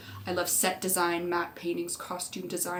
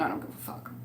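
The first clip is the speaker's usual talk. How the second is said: whispered, much lower aside; under-breath aside; 10 semitones lower, 8 dB quieter, faster than usual. Real, not acted. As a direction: drop it to a whisper for the punchline. Muttered aside about Colin.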